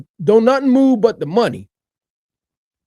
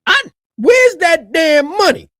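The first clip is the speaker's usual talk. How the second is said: slow, loud, higher demand; angry shout; 7 semitones higher, 3 dB louder than usual. Real, not acted. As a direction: yell it out, fed up. Ranting about taxpayer money.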